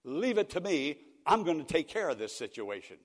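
irate daughter. Retold or imitated. Daughter vowing to stop the divorce, loud and firm. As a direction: she will fix this herself. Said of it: "she became almost irate".

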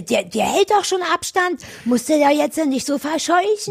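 lightly voiced indignant owner; a hint of a scolding dog owner; annoyed owner. Dog owner's retort retold.

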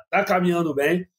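usual speaking voice

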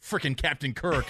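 usual speaking voice

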